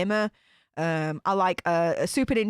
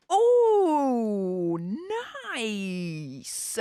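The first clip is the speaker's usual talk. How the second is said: delighted approval. Real, not acted. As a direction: savour it, stretching both words. Delighted by a viewer's ringtone.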